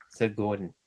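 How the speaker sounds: usual speaking voice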